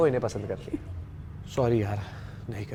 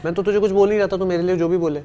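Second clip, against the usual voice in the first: louder, higher heated retort at the rival; 5 semitones higher, 4 dB louder, faster than usual. Real, not acted. To the rival contestant, a heated raised retort. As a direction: push back hard at him.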